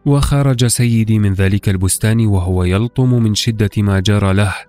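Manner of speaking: ordinary delivery, nothing marked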